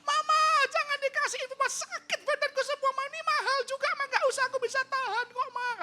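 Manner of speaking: put-on child's very high, loud pleading wail; squealed, tearful protest; [name]. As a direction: imitate a child in pain begging Mama.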